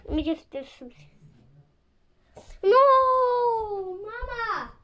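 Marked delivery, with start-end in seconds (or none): 2.6-4.7 whining cry. Real, not acted. Upset, crying to mama.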